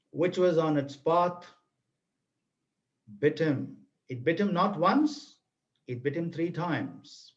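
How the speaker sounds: grave storyteller voice, unhurried; story narration. A narrator's reading. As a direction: let the danger land word by word. Narrates the snakebite scene.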